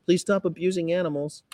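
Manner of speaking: high-pitched, syrupy polite plea; saccharine nice activist. Acted. Activist answering rude passers-by, saccharine sweet. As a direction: plead sweetly in a high put-on voice. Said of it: "That's mostly the way it is".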